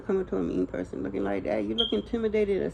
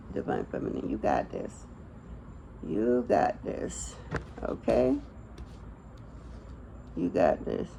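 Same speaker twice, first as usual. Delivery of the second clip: soft reassurance; 11 semitones lower, 6 dB quieter than usual. Real, not acted. Reader to the Divine Feminine, hushed and reassuring. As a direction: gently reassure her she can cope.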